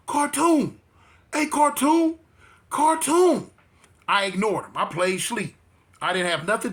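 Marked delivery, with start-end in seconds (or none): 0.1-3.5 higher-pitched call across the cells; imitated calling out. Inmate calling the storyteller from his cell, imitated calling out. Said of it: "he had called me one time".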